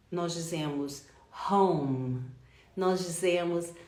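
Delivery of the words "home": "home" with a clear breathy H, pitched low; H pronounced. Shown as a demonstration. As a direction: sound the H at the start of "home". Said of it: "o H é pronunciado".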